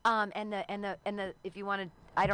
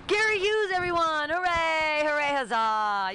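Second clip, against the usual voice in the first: big loud cheering announcement, much higher; host hype shout; 10 semitones higher, 10 dB louder, slower than usual. Real, not acted. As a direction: whip the crowd up for the comic. Host hyping next comic.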